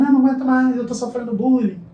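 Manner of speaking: high, loud tearful complaint; a hint of a sobbing child; daughter crying. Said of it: "e ela chorando".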